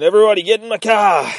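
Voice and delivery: high, loud and fast rallying yell; mock-heroic bellow; excited shout. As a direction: hurry everyone in with a big shout. Grizzly calls everyone to flee.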